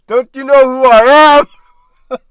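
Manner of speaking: loud, high mock arrogance; entitled boss. Arrogant manager demanding respect, loud mock indignation. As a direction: demand it like a self-important boss.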